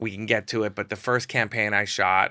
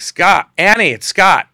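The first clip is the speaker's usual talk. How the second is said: loud call through a door, higher; 9 semitones higher, 13 dB louder, slower than usual. Retold or imitated. Calling loudly to the crying model behind the door.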